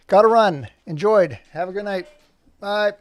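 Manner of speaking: loud, bright goodbye; loud sign-off. Acted. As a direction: call out a quick goodbye.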